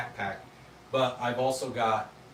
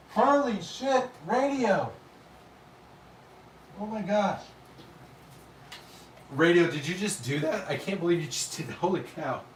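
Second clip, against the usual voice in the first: excited; 5 semitones higher, 3 dB louder than usual. Real, not acted. Huge donation just came in.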